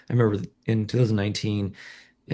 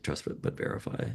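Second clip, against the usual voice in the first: whispered; 2 semitones lower, 10 dB quieter than usual. Real, not acted. Repeats host's phrase softly.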